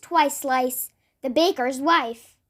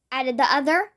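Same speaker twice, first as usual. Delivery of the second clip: ordinary delivery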